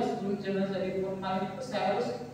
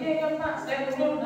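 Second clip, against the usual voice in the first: higher-pitched quoted agreement; retold anxious reply; 7 semitones higher than usual. Relative answering the doctor about critical news, retold high and anxious. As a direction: imitate a flustered yes, pitched up.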